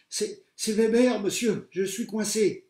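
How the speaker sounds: high loud halting plea, slow; trapped pupil calling to the teacher; trapped and panicked. A narrator's reading. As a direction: call out stuck and scared.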